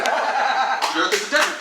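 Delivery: slow, laughing ad tagline; hard sell ad. Pretend. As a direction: announce it like a slogan. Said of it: "say it like an advertisement".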